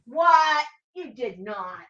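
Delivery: ordinary talk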